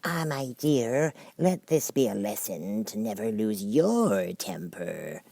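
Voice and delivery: put-on very slow, lecturing old-lady voice; old crab lecturing her daughter; old crab mother. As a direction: preach it slowly like a wise old crab.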